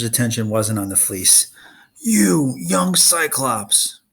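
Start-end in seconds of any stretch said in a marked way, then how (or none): 2.0-4.0 roaring Cyclops. Acted. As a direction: bellow at the young Cyclops. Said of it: "Polyphemus roared".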